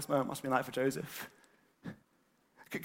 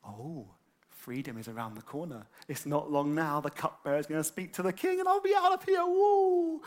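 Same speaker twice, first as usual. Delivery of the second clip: hope to glee; 3 semitones higher, 5 dB louder than usual. Retold or imitated. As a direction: let hope bubble into a cheer.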